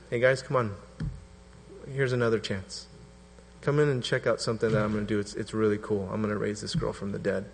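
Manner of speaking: a soft, gracious call to come along; gracious Jesus. Retold or imitated. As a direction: coax them in kindly and quietly. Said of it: "how gracious Jesus is".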